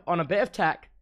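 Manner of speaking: normal talking voice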